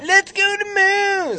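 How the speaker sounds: very high, slow ad shout; commercial jingle voice. Retold or imitated. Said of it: "that's what the commercial sounded like".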